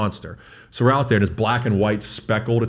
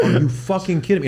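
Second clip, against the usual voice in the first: exasperated disbelief; 8 semitones higher than usual. Retold or imitated. Exasperated at the backup guy.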